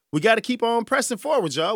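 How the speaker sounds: rallying. Real, not acted. Rallying listeners.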